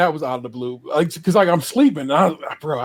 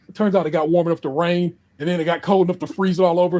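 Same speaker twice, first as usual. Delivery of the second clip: normal talking voice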